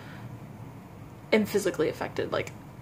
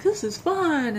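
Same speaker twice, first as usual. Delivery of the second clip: higher, lightly gleeful quote; imitated amused delight; 7 semitones higher, slower than usual. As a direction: mimic someone having great fun. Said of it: "they're all laughing".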